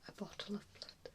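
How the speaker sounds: normal talking voice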